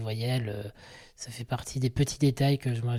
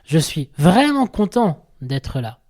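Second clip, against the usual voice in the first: vraiment hit hard and loud, slow and falling; slow and loud, vraiment punched with a falling tune; stress on vraiment; 3 semitones higher, 8 dB louder, slower than usual. Shown as a demonstration. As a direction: lean hard on the word vraiment, slowly. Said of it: "Deuxième version".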